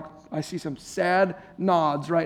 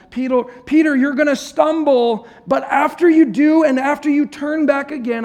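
urgent warning; 8 semitones higher, 7 dB louder than usual. Acted. Jesus warning Peter earnestly.

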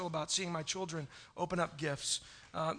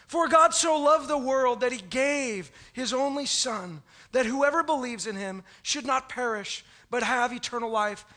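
scripture reading; 3 semitones higher, 4 dB louder than usual. Real, not acted. Pastor reciting John 3:16.

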